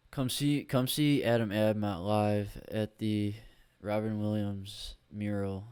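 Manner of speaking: loud, slow announcer plug; hammed-up ad read. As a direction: hype the show like a carnival barker. Plugging his live show.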